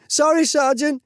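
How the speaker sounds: high-pitched frantic plea; screamed apology. A narrator's reading. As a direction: cry it out like a terrified trainee. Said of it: "He was repeatedly screaming".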